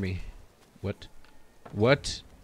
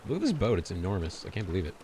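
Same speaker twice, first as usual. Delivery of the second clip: usual speaking voice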